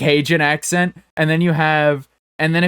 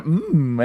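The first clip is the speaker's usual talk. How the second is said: joyful "mm"; 2 semitones higher than usual. Shown as a demonstration. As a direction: imitate a delighted little moan, pitched up. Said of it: "filled with joy to do it".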